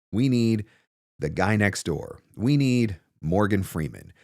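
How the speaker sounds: ordinary talk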